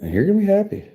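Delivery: high fast put-on voice for "ten dollars"; mock stingy donor. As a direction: talk down to him like a smug dealmaker. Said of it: "be like".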